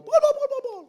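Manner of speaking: high, loud, rapid gobbling; mock turkey gobble. Preacher jokes with a turkey in place of rooster, gobbling. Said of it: "hindi pabo".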